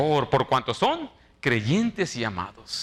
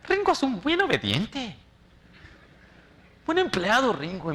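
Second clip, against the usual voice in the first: much higher, sing-song praise; mock praising boss; 7 semitones higher than usual. Pastor imitates his supervisor praising him to everyone, mock gushing. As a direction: gush like the boss lady.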